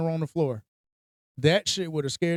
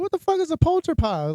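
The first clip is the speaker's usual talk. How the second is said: higher, baffled question; incredulous; 10 semitones higher than usual. Retold or imitated. Retelling his baffled reaction to a game item, incredulous. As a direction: ask it in disbelief. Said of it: "I'm like".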